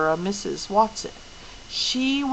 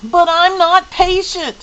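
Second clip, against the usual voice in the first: high, loud, passionate protest; a girl crying out passionately; 11 semitones higher, 9 dB louder than usual. Acted. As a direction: burst out at them, pleading. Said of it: "Meg cried passionately".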